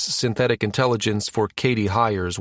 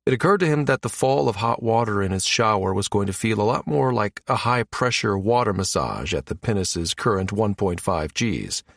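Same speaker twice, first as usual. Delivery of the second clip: plain reading voice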